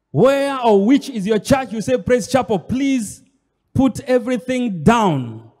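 loud, driving sermon cadence; forceful preaching. Acted. Preacher presses the congregation, loud and urgent. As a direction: press the question home to the flock.

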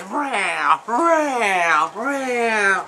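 drawn-out, falling meows; mock cat cries, each one sliding down; imitated cat yowl. Imitates fighting cats.

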